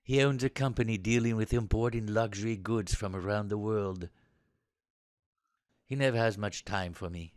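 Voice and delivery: low, soft, reflective admission; subdued confession. Acted. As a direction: confide reluctantly, energy drained. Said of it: "after a deep intake of breath".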